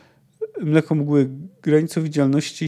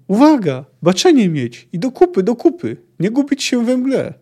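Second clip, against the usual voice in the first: high, loud commands to the men; shouted rallying warning; yelled orders; 8 semitones higher, 6 dB louder than usual. A narrator's reading. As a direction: bark urgent orders across the fog. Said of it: "wrzasnął".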